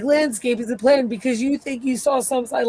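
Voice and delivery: lofty scornful put-down; high and mighty. Retold or imitated. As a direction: talk down to her from on high. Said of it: "high and mighty with Jade".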